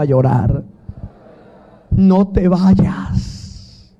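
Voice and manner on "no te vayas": slow, breathy whispered plea; pleading whisper. Acted. Jacob begging the angel not to leave, slow pleading whisper. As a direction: whisper it slowly, begging him to stay.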